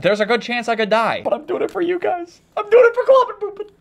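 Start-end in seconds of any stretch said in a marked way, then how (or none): 1.2-3.7 high-pitched strained declaration; heated, strained. Real, not acted. Suffering gamer doing it for his fans, heated and strained. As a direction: push the voice up, close to losing it. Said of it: "I'm getting a little heated".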